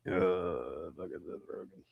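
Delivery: low, soft disgusted mutter; disgusted groan. Retold or imitated. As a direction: grumble in disgust under your breath. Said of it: "I'm like".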